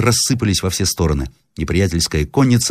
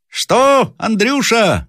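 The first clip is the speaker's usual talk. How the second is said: high, drawn-out call; крик сержанта; much slower than usual. A narrator's reading. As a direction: shout up to someone far above. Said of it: "закричал наконец сержант". (протяжный окрик)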